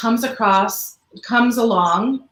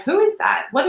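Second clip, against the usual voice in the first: higher, incredulous who is that; mock disbelief; 9 semitones higher than usual. Singer imitating her own surprise at hearing praise, higher. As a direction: play it as amazed at hearing praise. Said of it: "you're like".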